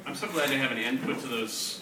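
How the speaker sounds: ordinary delivery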